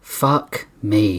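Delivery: ordinary delivery, nothing marked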